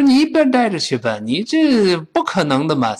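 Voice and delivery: hammed-up no-way, swooping in pitch; mock refusal. Teacher acts refusing the borrower, mock scornful brush-off. Acted refusal of car request.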